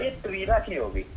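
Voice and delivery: usual speaking voice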